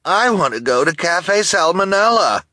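high, loud petulant snarl. A narrator's reading. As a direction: snarl your demand over the others. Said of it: "Esme snarled".

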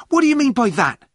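high, loud indignant outburst; angry shout. A narrator's reading. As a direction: shout it as an outraged challenge. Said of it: "he shouted".

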